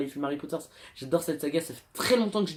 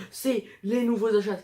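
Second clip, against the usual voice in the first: higher-pitched imitation of a big reveal; retold excitement, pitched up; mock excited announcement; 6 semitones higher, 4 dB louder than usual. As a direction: announce it brightly, imitating your earlier self. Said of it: "je les ai sortis du sac en mode".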